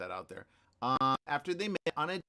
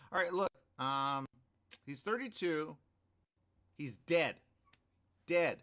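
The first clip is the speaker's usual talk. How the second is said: ordinary talk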